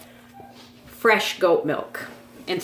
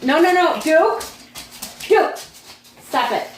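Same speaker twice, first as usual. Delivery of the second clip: scolding the dog; 8 semitones higher than usual. Real, not acted. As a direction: stop the dog firmly.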